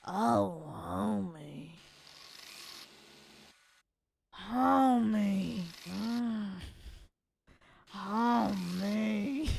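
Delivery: lightly voiced raspy cartoon mom; a hint of Marge Simpson saying homie; attempted Marge Simpson voice; Marge Simpson impression. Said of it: "May I interest you in Marge Simpson?".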